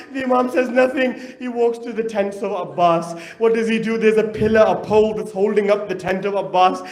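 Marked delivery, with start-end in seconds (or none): none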